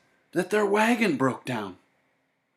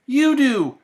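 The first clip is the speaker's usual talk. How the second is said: put-on high, louder boyish reply; Sam answering; 12 semitones higher, 7 dB louder than usual. A narrator's reading. Sam answering the old woman, high and louder. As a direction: snap back brightly as Sam. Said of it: "said Sam".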